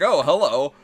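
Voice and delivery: loud, high imitation of a hello; mock greeting back. Half orcs answering the fighter who approached, hammed-up greeting. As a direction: play a big, hammed-up hello in return.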